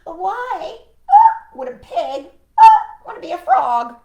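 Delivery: high, very loud parrot voice with squawks; screechy storybook parrot; squawking parrot. Acted. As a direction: squawk between phrases like a parrot. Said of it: "said the parrot".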